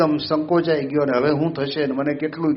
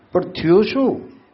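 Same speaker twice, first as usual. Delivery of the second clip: slow, higher, puzzled father asking; father's worried question; 5 semitones higher, 3 dB louder, slower than usual. Acted. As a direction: ask slowly like a worried father. Father asks son in story. (પિતા ધીમેથી ચિંતાથી પૂછે છે)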